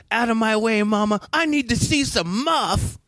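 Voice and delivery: imitated Booger. Panty raider to dorm adult blocking the way, high imitated lechery. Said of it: "that dude Booger's like".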